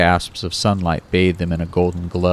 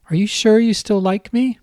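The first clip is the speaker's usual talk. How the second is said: much higher girlish voice with a hint of a man underneath; a grown man faking a little girl, pitched way up; babyish high voice. Kyle faking Kate's voice on the phone to Ron, high and babyish. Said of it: "his Cuddly Kate voice holding".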